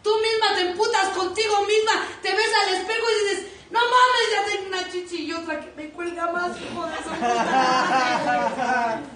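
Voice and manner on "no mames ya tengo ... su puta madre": loud mirror complaint. Acted.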